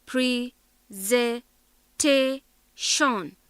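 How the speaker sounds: PRE, ZE, TE, SHON, separated by pauses; syllable by syllable. Shown as a demonstration. Teacher counts out four syllables, syllable by syllable. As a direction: sound out presentation one firm syllable at a time. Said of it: "four syllables".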